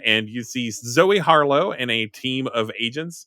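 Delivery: usual speaking voice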